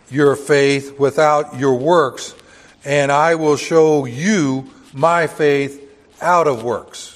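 slow, loud, weighty reading of the verse; measured King James reading; solemn scripture reading. Acted. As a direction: read it out like pulpit scripture. Reads scripture slowly and loudly.

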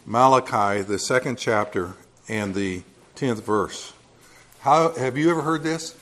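normal talking voice

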